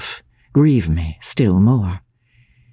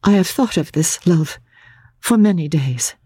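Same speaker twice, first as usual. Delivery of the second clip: put-on gentle young woman; 5 semitones higher than usual. A narrator's reading. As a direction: plead gently as Laura. Laura's voice in narration.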